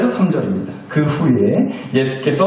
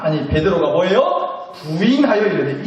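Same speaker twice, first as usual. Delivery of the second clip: ordinary talk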